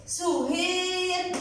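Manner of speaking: slow "suhiiir" for سهير; long vowel. Shown as a demonstration. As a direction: stretch the "ii" in سهير. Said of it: "مد". (مدّ الياء في سهير ببطء)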